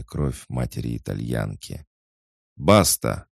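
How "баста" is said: loud, final Basta; stern snap. A narrator's reading. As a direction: slam the word Basta down. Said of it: "припечатал дядюшка".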